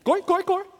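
high, quick bird-like chirps; chirping bird. Acted. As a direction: chirp it repeatedly like a tiny songbird. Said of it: "impersonation of a bird chirping".

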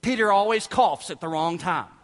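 usual speaking voice

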